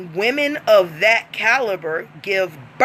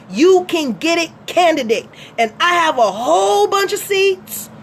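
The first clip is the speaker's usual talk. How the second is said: brash radio host persona; 8 semitones higher than usual. Acted. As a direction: sell it like a street radio DJ. Plays host character Fundi Fee.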